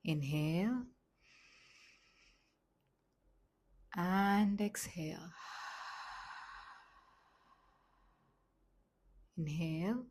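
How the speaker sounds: slow, gentle meditation voice with long pauses; softly paced relaxation instructions, leaving space to breathe; guided meditation. Acted. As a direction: guide them calmly, phrase by unhurried phrase.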